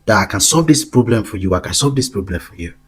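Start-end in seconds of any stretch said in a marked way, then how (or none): 1.5-2.7 said again more softly, like a pitch; quieter, persuasive echo of the offer; softer repeat. Shown as a demonstration. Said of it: "Go talk to them about that idea".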